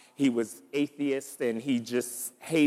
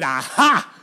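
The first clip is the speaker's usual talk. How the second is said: loud, sudden "caught you" burst; triumphant gotcha cry; 3 semitones higher, 8 dB louder than usual. Acted. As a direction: jump out on her with it. Man jumps out to surprise her.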